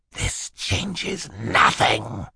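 slow, drawn-out villain hiss; menacing hiss. A narrator's reading. Stefano threatens the children in the car, hissing. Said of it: "he hissed at them".

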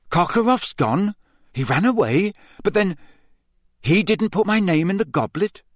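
put-on a confused boy, voice rising; bewildered Harry. A narrator's reading. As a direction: piece it together in disbelief.

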